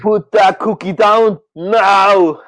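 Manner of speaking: mock Austrian action-hero orders; Arnold impression. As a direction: bark it out slowly like Arnold in an Austrian accent. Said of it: "the Arnold thing".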